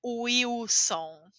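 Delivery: Wilson said uiuson, the L like U. Shown as a demonstration. Teacher showing the L in Wilson. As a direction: soften the L in Wilson to oo. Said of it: "como U também".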